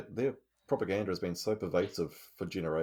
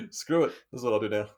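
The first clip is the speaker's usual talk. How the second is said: mock reckless quote; 2 semitones higher, 7 dB louder than usual.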